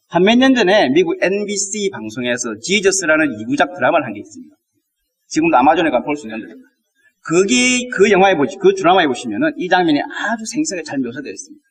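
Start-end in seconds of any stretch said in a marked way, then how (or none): none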